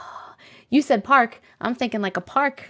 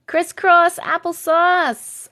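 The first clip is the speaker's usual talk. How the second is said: crisscross applesauce drawn out slow and higher, like a kindergarten teacher; teacher's crisscross applesauce; 6 semitones higher, 3 dB louder, much slower than usual. Shown as a demonstration. Teacher calling her class to sit, slow sing-song. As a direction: stretch every syllable of crisscross applesauce for little kids. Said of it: "Meditation style".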